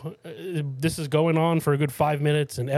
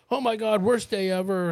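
distressed wail; 7 semitones higher than usual. Retold or imitated. Stranger wailing.

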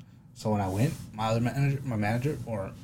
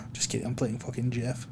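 normal talking voice

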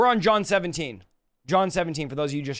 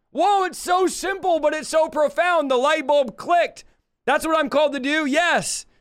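excited realisation; 8 semitones higher than usual. Pretend. Acting out a light-bulb realisation.

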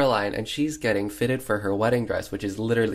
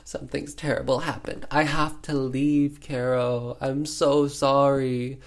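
hamming it up; 4 semitones higher than usual. Retold or imitated. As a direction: overplay a tragic confession. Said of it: "definitely hamming it up".

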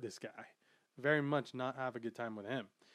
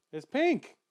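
quoting Luffy; 9 semitones higher, 5 dB louder than usual. Retold or imitated. Luffy answers.

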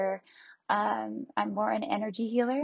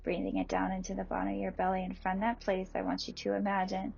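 guided meditation; 5 dB quieter than usual. Acted. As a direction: ease them gently into calm.

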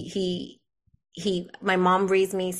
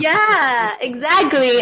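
much louder, higher, laughing yes; loud laughing agreement; 5 semitones higher, 10 dB louder, much slower than usual. Real, not acted. Speaker agreeing loudly with the laughing friend. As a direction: burst out agreeing, still laughing.

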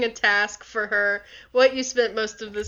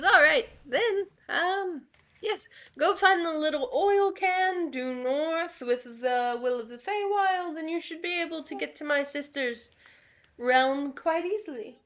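NPC hag voice; 5 semitones higher than usual. Acted. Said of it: "She goes".

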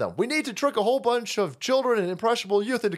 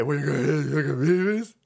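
mocking quote; 7 semitones lower than usual. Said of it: "when you say".

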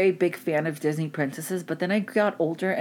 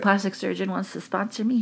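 ordinary talk, nothing marked

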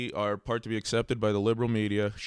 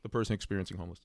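ordinary talk, nothing marked

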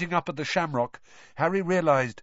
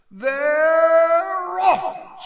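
high, loud, drawn-out cry; screaming announcer; 8 dB louder than usual. A narrator's reading. Bagman screaming as the match begins. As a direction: scream the start to the crowd. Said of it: "screamed Bagman".

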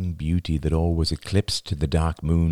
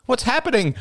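very high, alarmed question; frightened cry; 3 dB louder than usual. A narrator's reading. Girlfriend finding him lying discoloured on the floor, alarmed. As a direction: ask it in sheer alarm.

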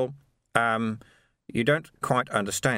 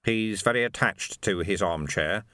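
ordinary delivery

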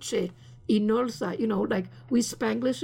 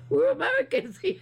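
mocking laugh. Mocking the family proud of flag.

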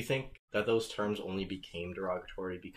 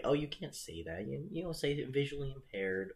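mocking scold; 6 semitones higher than usual. Mocking politically correct people.

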